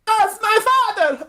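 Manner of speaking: put-on frantic girl yelling. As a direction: plead like a panicked young woman. Girl screams about robbed dad.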